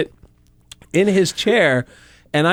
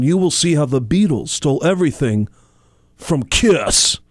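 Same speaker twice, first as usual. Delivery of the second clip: [name] impression. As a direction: pitch [name] like [name]. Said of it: "I just jumped in and went".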